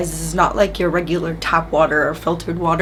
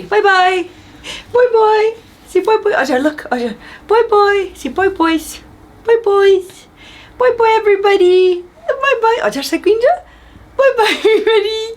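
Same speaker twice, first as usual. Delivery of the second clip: very high, louder sing-song byes; 5 dB louder than usual. Real, not acted. Aunt waving bye with her niece, high chirpy baby voice. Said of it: "Please ignore my baby voice".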